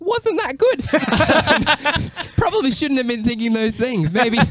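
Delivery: very high, slow, squealing with laughter; admitting the album was bad, laughing high. Real, not acted. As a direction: laugh it out at your own expense. Laughing at own old album.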